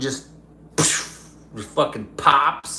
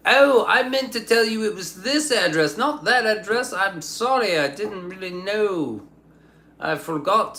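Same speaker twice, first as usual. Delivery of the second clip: higher put-on apologetic voice; lightly voiced fake apology, higher; mock apologetic guy; 8 semitones higher than usual. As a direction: play the sheepish neighbour in a higher voice. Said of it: "the guy's like".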